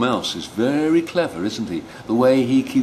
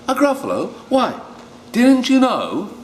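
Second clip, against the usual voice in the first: 1 mouse gasping; 4 dB louder than usual. Acted. Mouse teases owl.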